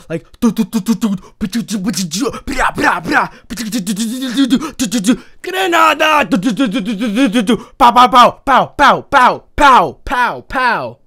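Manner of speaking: loud high-pitched imitated machine-gun bursts, do do do and pow pow; spray the room with shouted mouth-made gunshots, laughing through it; mouth gunfire.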